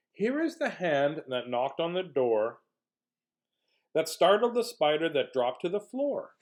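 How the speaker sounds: slow, sing-out picture-book reading with high openings; reading to children. A narrator's reading. Reader presenting a haunted house book to kids, storytelling. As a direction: read it slowly and dramatically for little kids.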